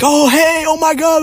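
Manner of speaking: very high squealed mock greeting; gushing greeting. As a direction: imitate an over-friendly hello in falsetto. Retold gushing greeting to a woman.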